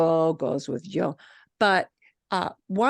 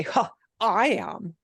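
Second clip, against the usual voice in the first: "Huh, I am" drawn out slowly, leaning on "I"; emphatic I am; slower than usual. Shown as a demonstration. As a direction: say "I am" slowly, pushing the "I". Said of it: "you do that with your voice in English".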